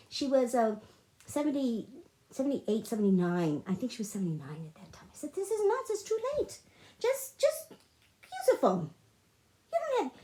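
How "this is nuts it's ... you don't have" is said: high-pitched, fed-up re-enacted plea; high, insistent exasperation; exasperated pleading. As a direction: replay arguing with mom, pitched way up. Retold pleading argument with mother.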